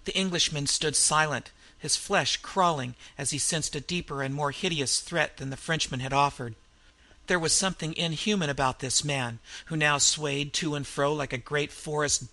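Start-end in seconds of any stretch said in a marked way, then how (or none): none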